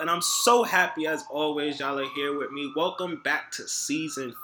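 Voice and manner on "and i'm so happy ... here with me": loud, upbeat show opener; podcast host intro. Acted. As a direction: hype up the welcome like a host.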